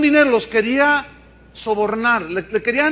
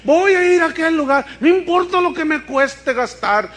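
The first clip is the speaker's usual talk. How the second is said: higher, louder, eager mock voice of people chasing power; mock-eager pilgrim voice, pitched up; mocking eager believers; 6 semitones higher, 4 dB louder than usual. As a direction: parody the desperate believer who'll travel anywhere. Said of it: "Hay muchos que dicen".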